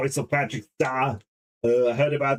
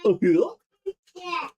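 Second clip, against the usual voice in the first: ordinary talk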